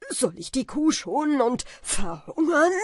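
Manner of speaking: höher und lauter, verbittert; wütender Schrei. A narrator's reading. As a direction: schleuder ihm die Frage entgegen. Wim schreit Kaspar an. (bitter schreiend)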